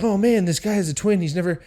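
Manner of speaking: high, eager rush of words; excited pleading. Retold or imitated. The boys trying to convince the cop, excited. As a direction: gush the news at the cop.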